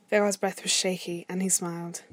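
ordinary delivery

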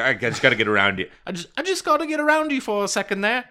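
high, tight put-on voice for a timid passerby; dog walker squeezing past a man on a Segway, squeaky and polite. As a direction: squeak it out, overly polite and nervous. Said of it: "And he's like".

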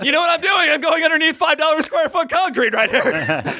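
high-pitched mock movie menace; hammed-up threat from under the truck; Robert De Niro. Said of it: "like, Robert De Niro in Cape Fear".

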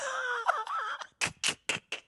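squeaky laugh breaking out high; high-pitched burst of laughter. Real, not acted. Cracking up at his own mock of shoppers, high laugh.

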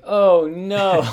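loud, high, drawn-out announcer cry; mock the announcer wailing in alarm; dismayed announcer. Imitating race announcers as the leader veers off course, loud dismayed cry. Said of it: "you can hear the announcers being like".

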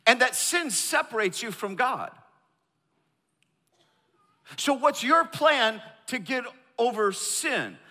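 high-pitched, insistent preacher delivery; impassioned preaching. Real, not acted. Preacher pressing the congregation on their plan for heaven, impassioned.

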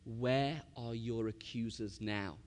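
slow, low and soft, without judgement; gentle question. Retold or imitated. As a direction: voice Jesus softly sparing the accused woman. Jesus gently addressing the woman.

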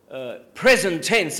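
usual speaking voice

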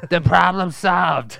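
drawn-out, high villain line; slow hammed-up villain; slow menacing villain. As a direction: savour each word like a gleeful killer.

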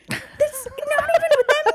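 high, squealing laugh through the words; cracking up in disbelief; incredulous laughter. Real, not acted. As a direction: protest helplessly through laughter. Incredulous laughter at the clip's nonsense.

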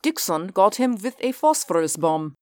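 commander voice. Acted. Reinhardt voice held.

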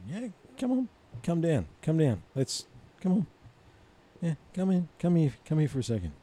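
high, teasing come-on call; mock beckoning taunt. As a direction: coax the invader in playfully. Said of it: "It's like".

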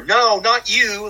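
high, slow, hammed-up soap opera line; melodramatic gasp. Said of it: "or Peter".

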